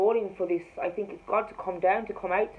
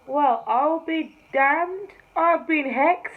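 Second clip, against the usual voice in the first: stunned exclamation; 8 semitones higher, 9 dB louder, much slower than usual. Retold or imitated. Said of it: "I'm like".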